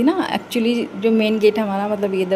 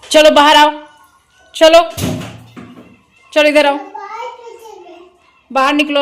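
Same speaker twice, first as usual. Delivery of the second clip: loud, higher-pitched motherly command; 6 semitones higher, 6 dB louder than usual. Real, not acted. Mother telling her toddler to come out of the bathroom, loud calling.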